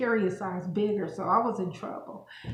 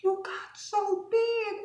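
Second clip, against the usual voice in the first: high, slow, blunt mock voice; imitating the nail lady; 13 semitones higher, 4 dB louder, slower than usual. Nail lady bluntly tells the customer she got fat, high slow mock voice. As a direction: drag out the lady's tactless verdict up high. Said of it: "She said".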